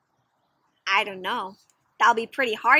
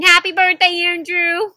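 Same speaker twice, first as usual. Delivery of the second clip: trying to be cheerful; 5 semitones higher, 4 dB louder than usual. Acted.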